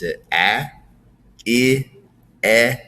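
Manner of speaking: short vowels sounded one at a time, "a" as in catch, "I" as in pitch; separate vowel sounds. Shown as a demonstration. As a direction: sound out each vowel alone, with pauses between. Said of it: "so the vowels that you can see".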